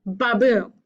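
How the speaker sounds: ba-BOOM, light "ba" and punched "boom"; stress on second syllable. Shown as a demonstration. Teacher showing what one iamb is, ba-BOOM beat. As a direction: land hard on boom in "ba-boom". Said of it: "you get the second syllable, the beat".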